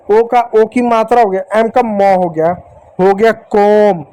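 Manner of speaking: ordinary delivery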